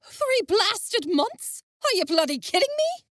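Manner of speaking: stunned outrage at the news; aghast. Acted. Envis reacting to the pregnancy, aghast.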